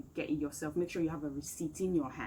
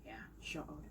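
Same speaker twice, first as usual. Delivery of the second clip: usual speaking voice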